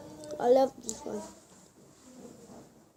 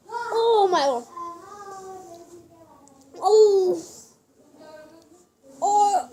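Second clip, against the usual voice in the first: shocked disgust; 5 semitones higher, slower than usual. Real, not acted. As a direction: recoil from a bad taste. Disgust after tasting chocolate.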